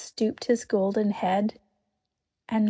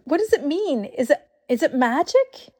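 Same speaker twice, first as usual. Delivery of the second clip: higher, louder, fearful questions; frightened pleading; 6 semitones higher, 5 dB louder than usual. A narrator's reading. Susan asks in fear whether it is magic. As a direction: beg to know, scared. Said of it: "cried Susan".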